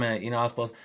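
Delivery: normal talking voice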